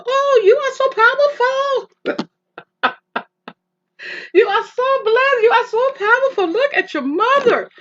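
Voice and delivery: very high, loud, tearful voice of the chaplain; high gushing chaplain. Retold or imitated. Chaplain praising the daughter, high and tearful. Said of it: "She said".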